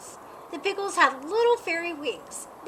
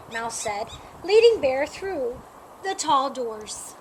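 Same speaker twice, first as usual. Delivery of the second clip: plain reading voice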